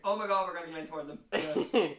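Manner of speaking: loud, hammed-up fan hype; mock excitement. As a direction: pretend to be a giddy hopeful fan, loudly.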